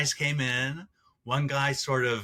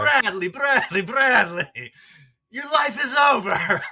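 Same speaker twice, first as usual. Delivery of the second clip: put-on loud, high Russian accent shout; 10 semitones higher, 8 dB louder, slower than usual. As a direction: yell it in a thick Russian accent. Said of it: "he had this thick Russian accent".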